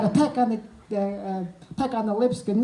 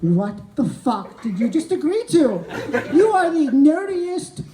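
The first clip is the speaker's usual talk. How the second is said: the sane inner voice scolds [name], mocking rant; inner critic rant; 5 semitones higher than usual. The sane brain berating him, shrill mocking rant. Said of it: "sane [name]".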